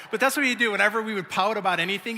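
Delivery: normal talking voice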